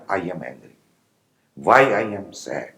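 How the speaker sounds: normal talking voice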